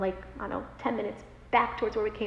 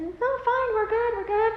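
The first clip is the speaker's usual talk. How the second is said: high-pitched brush-off; retold insistence that all is well; breezy dismissal; 11 semitones higher, 3 dB louder than usual. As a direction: wave off concern in a high voice. Brushing off emergency personnel.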